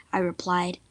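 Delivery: ordinary delivery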